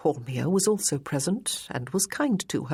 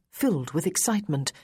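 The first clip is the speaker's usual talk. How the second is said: ordinary delivery, nothing marked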